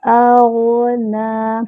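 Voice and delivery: أَغْنَى with the long vowel held out; tajweed demo. Shown as a demonstration. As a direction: stretch the madd in أَغْنَى slowly. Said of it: "چار سے پانچ حرکات برابر لمبا کریں گے".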